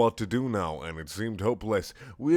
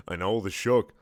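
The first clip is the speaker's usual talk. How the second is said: ordinary delivery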